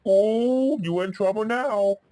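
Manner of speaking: high, drawn-out schoolyard taunt; teasing "ooh". Acted. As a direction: stretch the opening and rise in pitch. Ben taunts Ginger after punch.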